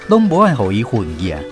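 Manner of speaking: ordinary talk, nothing marked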